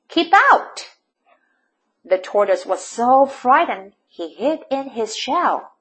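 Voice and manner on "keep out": high, loud warning shout; angry yell. A narrator's reading. Cactus chasing off a tortoise, high yell. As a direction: shout it sharply to drive them away. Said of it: "yelled Hank".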